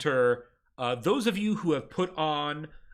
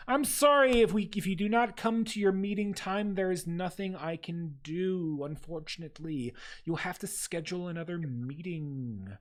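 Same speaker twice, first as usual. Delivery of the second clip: desk clerk; 2 semitones higher than usual. Acted. Ministry clerk greets the ragged party, primly bureaucratic. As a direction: recite the rules like a tired official.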